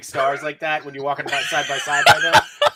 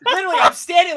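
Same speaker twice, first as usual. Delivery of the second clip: excited; 11 semitones higher, 5 dB louder than usual. Real, not acted. Excitedly retelling the scare.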